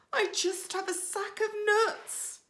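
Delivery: high, small squirrel voice; high, trembling little squirrel; timid squirrel. Acted. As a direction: squeak it out nervously. Squirrel's frightened reply.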